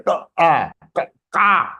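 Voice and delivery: half Ka, then long A "aah", blending into "kaa"; long vowel breakdown. Shown as a demonstration. Teacher joins half Ka to long A for students, sounded out. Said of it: "half Ka is attached with A".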